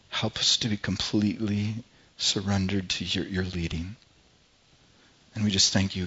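hushed, earnest prayer voice; soft closing church prayer; softly spoken prayer. Acted. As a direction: pray it quietly to God, unhurried.

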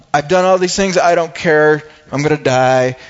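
loud, defiant outburst dropping in pitch; bitter defiance. Acted. Unrepentant criminal facing death, bitterly defiant. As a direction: throw it out loudly, past caring. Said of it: "holding on to all of his bitterness".

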